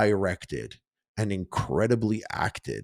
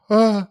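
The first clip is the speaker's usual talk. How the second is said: retold gasp; 10 semitones higher, 8 dB louder than usual. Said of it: "she's like".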